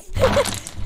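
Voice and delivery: short high-pitched giggle; amused laugh. Acted. Friend laughing off Brianna's odd claim, amused giggle. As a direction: burst into a brief high laugh.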